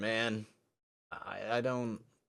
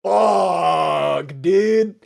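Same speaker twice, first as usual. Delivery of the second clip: much louder, higher shouted curse; 8 semitones higher, 14 dB louder than usual. Real, not acted. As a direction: yell it in alarm. Startled outcry at giant teacher.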